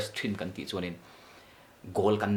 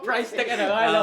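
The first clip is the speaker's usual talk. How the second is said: price tag blurted high and loud through a laugh; 13 semitones higher, 7 dB louder, much faster than usual. Real, not acted.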